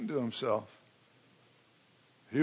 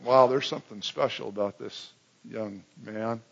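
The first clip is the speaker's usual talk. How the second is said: ordinary talk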